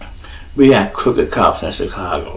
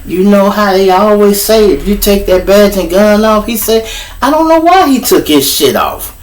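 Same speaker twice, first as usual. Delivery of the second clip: high, exasperated whine; a cop griping that he's in trouble; whiny cop; 9 semitones higher than usual. Retold or imitated. Officer griping to his partner, high and whiny. Cop explaining fight to Sarge.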